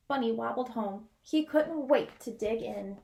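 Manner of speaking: plain reading voice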